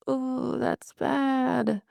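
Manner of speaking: the sinking moment you know the worst; dawning dread. Retold or imitated. Realizing unknown caller means death.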